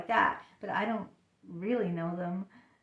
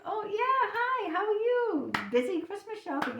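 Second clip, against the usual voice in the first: party small talk; 12 semitones higher than usual. Acted. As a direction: toss out a high-pitched passing hello. Acted party small talk while escaping.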